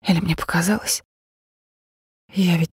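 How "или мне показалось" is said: usual speaking voice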